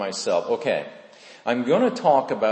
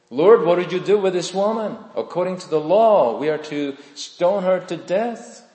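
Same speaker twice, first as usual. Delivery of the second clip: higher, louder challenging demand; accusing crowd; 7 semitones higher, 5 dB louder than usual. Retold or imitated. Accusers challenging Jesus over the woman, loud and high. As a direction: demand an answer, raised and pushy.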